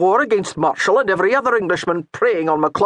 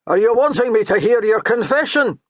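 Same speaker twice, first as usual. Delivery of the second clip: loud, mocking call back; shouting back; 5 semitones higher, 5 dB louder than usual. A narrator's reading. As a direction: throw the question back with a jab. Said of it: "the priest shouted back".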